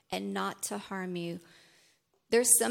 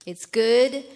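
loud, high burst of praise; loud exclamation; 4 semitones higher, 12 dB louder than usual. Real, not acted. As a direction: shout it out.

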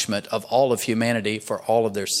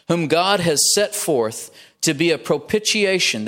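slow, raised-pitch scripture reading; stately, unhurried verse reading; 4 semitones higher than usual. Real, not acted. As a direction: read the verse out slowly and deliberately. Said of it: "I'm gonna read it slowly".

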